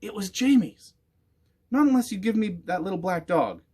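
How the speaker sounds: put-on high loud boy bargaining; stubborn boy making his demand; defiant boy. A narrator's reading. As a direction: Jamie bargaining with the witch for the dog. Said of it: "boy bargaining with the witch".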